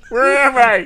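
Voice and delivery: high, loud bewildered cartoon voice; hammed-up dazed hero; silly cartoon Superman. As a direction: belt out the confusion comically. Voicing a confused Superman in the skit.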